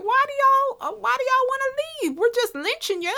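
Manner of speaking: high-pitched, whiny mock plea.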